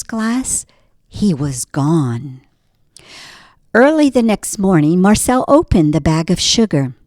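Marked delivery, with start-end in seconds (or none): none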